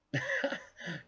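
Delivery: a sudden high burst of laughter. Real, not acted. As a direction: laugh high at your own story. Laughing at own story.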